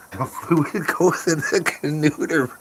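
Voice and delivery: high, slow, goofy repeat through laughter; laughing mock voice. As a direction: parrot the phrase in a silly high voice, cracking up. Repeating a garbled phrase, laughing.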